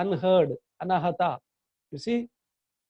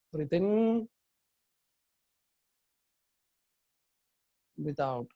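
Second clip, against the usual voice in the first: guided meditation. Real, not acted. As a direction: lead them gently through the breath. Leads a guided chakra meditation.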